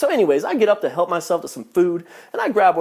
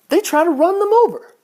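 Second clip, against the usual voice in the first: higher, louder outraged punchline; exasperated exclamation; 11 semitones higher, 6 dB louder than usual. Real, not acted. Comic describing Filipino drivers, loud outraged. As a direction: throw it out loud and high. Exaggerated complaint about drivers.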